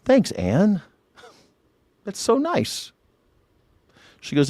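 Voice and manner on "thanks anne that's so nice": mock sweet thanks. His acted reply to Anne.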